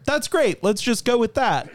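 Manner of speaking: high-pitched imitation of an excited director. Director approving the actor's voice in a retold story, excitedly. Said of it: "he was so excited".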